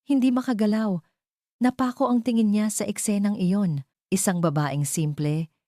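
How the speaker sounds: ordinary delivery, nothing marked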